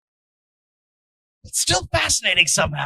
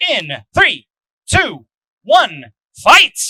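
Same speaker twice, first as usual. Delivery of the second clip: countdown call; 8 dB louder, much slower than usual. Real, not acted. Countdown to start the match.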